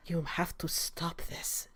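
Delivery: hushed urgent whisper. A narrator's reading. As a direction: whisper it so the healers can't hear.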